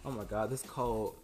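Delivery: usual speaking voice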